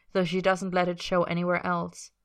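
ordinary delivery, nothing marked

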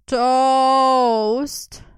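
loud, high drawn-out plea for toast; imploring. A narrator's reading. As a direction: beg loudly like hungry zombies. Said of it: "the girls implored".

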